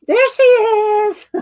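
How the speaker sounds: high, slow sing-song hello; drawn-out delighted hello; playful greeting. Real, not acted. Greeting a viewer in chat.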